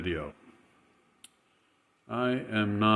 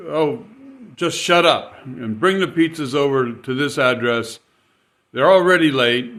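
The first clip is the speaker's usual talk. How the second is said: snappy, louder, higher mock rant; irate customer; 4 semitones higher, 6 dB louder than usual. Customer snapping at the driver, louder and higher. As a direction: play the furious customer demanding her pizzas. Angry customer voiced in story.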